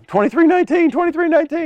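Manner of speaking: high-pitched panicked cartoon shriek; Monsters, Inc. alarm. Preacher quotes monsters panicking over a sock, high squeal. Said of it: "the Monsters, uh, Inc".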